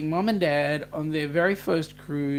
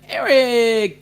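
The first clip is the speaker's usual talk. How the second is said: excited call; 6 semitones higher, 10 dB louder than usual. Real, not acted. Greeting a viewer by name.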